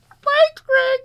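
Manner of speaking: very high, bright-pitched mock thank-you; squeaky falsetto thanks. Shown as a demonstration. Host thanking Greg in a squeaky high voice. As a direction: jump way up into a silly falsetto. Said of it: "I'm many personalities".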